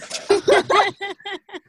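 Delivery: loud high laugh; burst of laughter. Real, not acted. Storyteller laughs at choking on water, loud laugh. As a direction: laugh out at herself.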